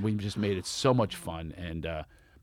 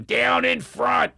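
mock Philadelphia heckler, higher, louder and slow; hammed-up Philadelphia accent heckling from behind; bad Philly accent; 12 semitones higher, 6 dB louder, much slower than usual. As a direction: drag it out like a rude Philadelphia fan. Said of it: "with the bad accent".

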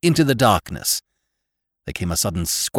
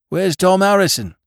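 panting, raised question; breathless gasp; 10 semitones higher, 5 dB louder than usual. Acted. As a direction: gasp it out mid-stroke. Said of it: "gasped one of the men".

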